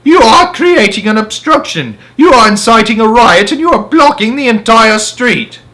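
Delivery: high, loud, furious listing; angry bellow. A narrator's reading. Policeman bellowing charges at Henry. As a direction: hammer each charge out.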